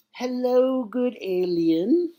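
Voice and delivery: loud, high, drawn-out greeting; captain greeting an alien. Acted. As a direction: hail an alien slowly and very loudly. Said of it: "talks to one of the aliens".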